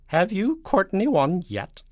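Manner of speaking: high, loud, slow icy demand. A narrator's reading. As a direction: press the question coldly and slowly. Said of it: "in an icy voice".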